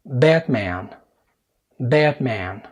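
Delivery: "باتمان" as English Batman with voiced b; English B sound. Shown as a demonstration. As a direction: model "باتمان" slowly for a listening test. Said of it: "ال ب بالعربي".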